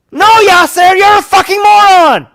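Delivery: very high, loud insult; furious shout. Real, not acted. Yelling an insult at commentator Yasser, furious. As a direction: scream it at him.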